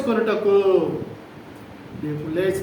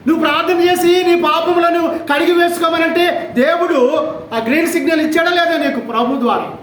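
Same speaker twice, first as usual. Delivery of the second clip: higher, declaiming God's promise; preaching at full force; 8 semitones higher, 4 dB louder than usual. Acted. Preacher voicing God's assurance to the sinner, fervent preaching. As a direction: proclaim it like a solemn vow. Sermon climax question.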